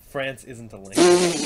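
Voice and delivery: slow mock quote. Reading a dumb chat comment aloud, slow and mocking. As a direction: drag it out to mock the mistake.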